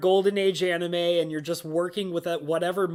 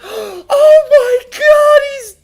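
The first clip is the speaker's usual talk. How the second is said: high shrieking melodrama, very loud; overdramatic mother; 16 dB louder, much slower than usual. Retold or imitated. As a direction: overact a hysterical mother's cry. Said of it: "put up this whole show".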